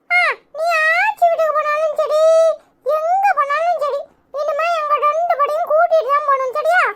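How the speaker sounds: put-on high-pitched child voice, making demands; insistent little child. Child to mother about taking both along, plaintive insistence. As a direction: insist like a small child, stretching the words.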